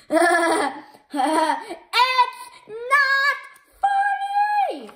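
high, loud outraged yell; furious hero shouting back; angry shout. Acted. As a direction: scream that it's not funny.

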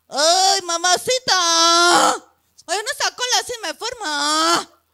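grito de piropo callejero, alto; piropo gritado. Retold or imitated. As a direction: imita a los que gritan en la calle. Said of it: "Y les gritaban". (gritando piropos como hombre de la calle)

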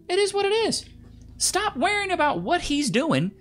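high, exasperated lecturing; exasperated high rant. Real, not acted. Ranting at women, high voice.

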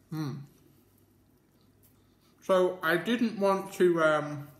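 chewing while talking; muffled with food; mouth full. Real, not acted. Seen 0: bite at -1, cheeks full and chewing through 2, talking after.

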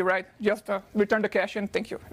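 usual speaking voice

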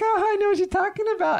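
put-on very high, fast cutesy girl voice. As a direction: squeak it out sweetly. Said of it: "They've got their, like, cute".

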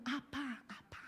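very soft, far-off little calls; child calling abba. Retold or imitated. Pastor imitates Israeli children calling their dad, softly. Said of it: "你如果听以色列人".

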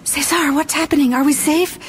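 high, urgent questioning; anxious Angelique. Acted. Frightened girl questions her rescuer from hiding, anxious.

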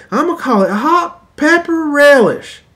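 high, loud, slow proud announcement; mock proud inventor. Husband names his creation to his wife, proudly. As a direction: announce the name grandly. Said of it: "What are you gonna call it".

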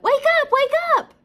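fast, shrieked high wake-up call; crying out. A narrator's reading. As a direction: shout urgently to rouse a sleeper. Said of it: "cried Ladybug".

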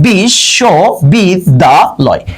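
বিশ্ববিদ্যালয় spoken very slowly, syllables spaced out. Shown as a demonstration. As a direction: stretch বিশ্ববিদ্যালয় into separate slow beats. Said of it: "বাংলিশে convert করব, দেখেন". (বিশ্ববিদ্যালয় খুব ধীরে, প্রতিটি অক্ষর আলাদা)

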